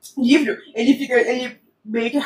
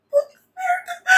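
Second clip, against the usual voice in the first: guincho agudo de susto; choque imitado; slower than usual. Retold or imitated. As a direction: solta o palavrão num agudo chocado. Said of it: "eu tô tipo". (palavrão agudo de espanto imitado)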